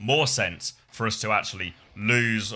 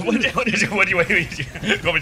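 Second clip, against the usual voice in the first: laughing; 9 semitones higher, 6 dB louder, much faster than usual. Real, not acted. Laughing protest at being teased.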